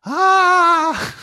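high, longing "ah"; yearning cry. Shown as a demonstration. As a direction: let out a high yearning sigh. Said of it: "when I say yearning".

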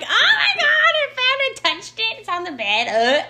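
high, laughing mock scream, fading out; half-laughing shriek of disgust to her best friend. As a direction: shriek it down the phone to a best friend, then let it drop. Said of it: "just scream in her ear like".

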